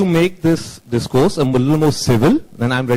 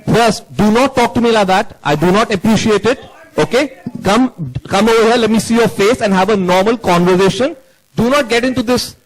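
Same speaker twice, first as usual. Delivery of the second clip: stern, raised-pitch dressing-down; angry rebuke; 6 semitones higher than usual. Real, not acted. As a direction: scold the reporter firmly, pitched up. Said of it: "Calm down".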